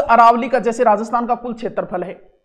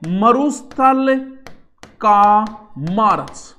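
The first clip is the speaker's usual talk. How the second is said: the term drawn out slowly, word by word; slow spelled-out term; 4 dB louder, much slower than usual. Shown as a demonstration. Teacher introducing the new term, said slowly. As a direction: lay out each word for the class, slow and careful. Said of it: "देखो, शब्द ये है".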